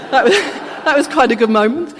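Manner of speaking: amused, giggling aside; laughing. Real, not acted. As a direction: chuckle while you say it. Laughing at the shearers calming down.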